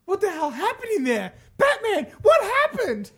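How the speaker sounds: high, strained cry to Batman; frantic character voice. Acted. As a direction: call out in high-pitched alarm. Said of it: "Did I do that in the right voice?".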